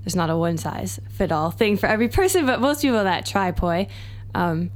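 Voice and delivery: normal talking voice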